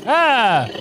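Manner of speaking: sudden loud yelp; startled shout. Acted. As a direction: shout in fake fright. Tiger roar reaction.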